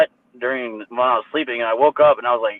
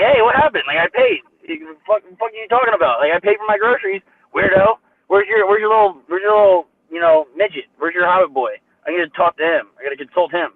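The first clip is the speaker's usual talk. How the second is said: much higher, mocking taunt; retold angry gloating; 4 semitones higher than usual. Retelling confronting the staff lady after paying, gloating. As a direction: rub it in her face, indignant. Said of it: "I'm like".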